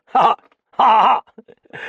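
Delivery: loud, high boozy guffaw; loud tipsy ha ha; drunken laugh. Acted. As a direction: burst out in a sloshed cackle.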